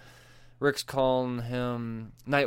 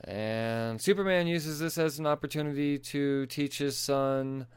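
ordinary delivery

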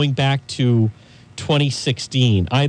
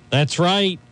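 ordinary talk, nothing marked

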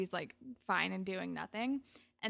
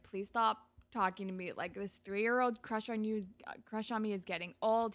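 ordinary talk, nothing marked

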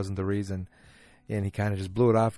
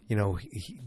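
ordinary talk

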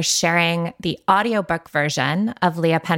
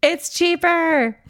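slow, much higher, drawn-out delight; playful echo; 11 semitones higher, slower than usual. Real, not acted. Co-host echoing the price news to listeners, drawn-out and delighted. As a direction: savor the word like a treat.

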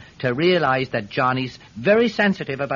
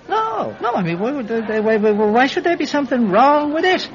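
high-pitched stammering protest; panicky denial. Acted. Guilty friend questioned about the cap, panicky stammer. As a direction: trip over the words, protesting too much. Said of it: "You're looking a wee bit pale".

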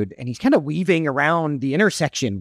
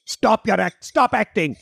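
put-on Indian accent; 2 semitones higher than usual. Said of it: "Why does he have an Indian accent?".

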